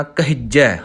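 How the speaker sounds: ਕਹਿਜੈ with a tight, doubled j; ਕਹਿਜੈ held tight on the h and j; ਕਹਿਜੈ pressed firmly, the j doubled; correct pronunciation. Shown as a demonstration. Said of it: "ਥੋੜ੍ਹਾ ਕੱਸ ਕੇ ਕਰਨਾ ਹੈ".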